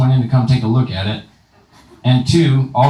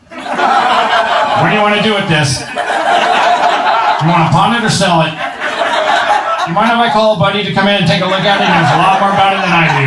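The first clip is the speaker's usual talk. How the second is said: Rick straining; 6 semitones higher than usual. Retold or imitated. Comedian imitating Rick with a girl, strained and laughing. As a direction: play Rick pushing like he needs the toilet. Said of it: "He gets down there".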